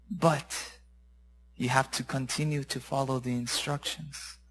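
very soft, low, measured preaching; solemn exhortation. Real, not acted. Preacher telling listeners obedience will hurt, hushed and solemn. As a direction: drop to a hushed, earnest counsel.

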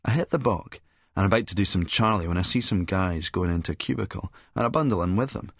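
ordinary delivery, nothing marked

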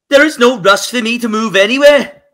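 mocking quote in a failed Scouse accent. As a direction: mimic the player in a poor Scouse accent. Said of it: "I can't do the Scouse accent".

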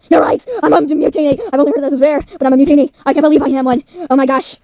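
loud, high exclamations of disbelief; acted, loud amazement; shocked realisation. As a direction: exclaim it in astonished alarm. Character realises it is a Mutini.

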